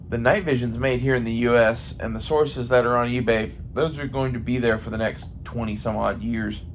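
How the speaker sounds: ordinary talk, nothing marked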